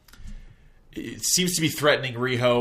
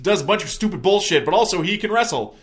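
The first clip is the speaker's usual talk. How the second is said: high, animated commentator spiel, getting louder; mock announcer; 6 semitones higher, 5 dB louder than usual. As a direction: hype him up like a ringside announcer. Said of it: "The announcer's getting him over as".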